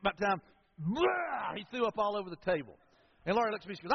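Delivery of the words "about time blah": slow, drawn-out mock throw-up noise; hammed-up vomiting sound; imitated retching. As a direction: act out a kid gagging and heaving. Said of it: "And he goes".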